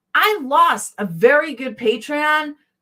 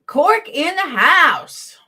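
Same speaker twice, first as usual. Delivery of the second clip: drawn-out, raised-pitch shout-out; calling out a greeting; 6 semitones higher, much slower than usual. Real, not acted. Streamer greets a viewer joining the chat, calling out. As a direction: announce an arrival, stretching the words high.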